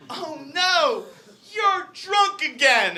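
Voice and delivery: high, slow mother's lament; dismayed mom. Retold or imitated. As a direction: wail it like a disappointed mother. Mom finding drunk son.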